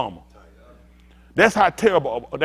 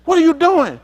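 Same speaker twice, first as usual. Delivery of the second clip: much higher caricature of an entitled passenger; 13 semitones higher, 7 dB louder than usual. Retold or imitated. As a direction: squeal it like an outraged traveler.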